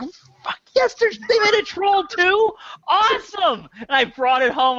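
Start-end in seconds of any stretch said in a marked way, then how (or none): 0.0-3.7 high, thrilled gasp of delight; overjoyed at finding a sequel; squealing fan excitement; excited fanboy. Retold or imitated. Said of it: "They're like".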